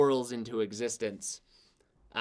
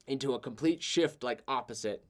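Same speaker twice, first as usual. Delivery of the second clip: usual speaking voice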